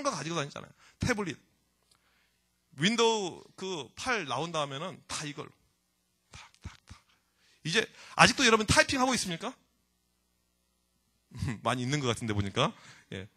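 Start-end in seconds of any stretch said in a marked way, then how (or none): 6.3-7.0 hushed, toneless tap sounds; very soft, spaced-out taps; imitated tapping. Shown as a demonstration.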